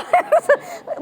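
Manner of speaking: sudden high squeal of a laugh; delighted giggle. Real, not acted. Laughing at own group.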